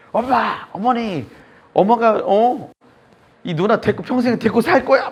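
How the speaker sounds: high, loud retold yelling at his mother; angry outburst. Brother-in-law yelling at his bedridden mother, angry shout. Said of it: "막, 막 싸댄 거야".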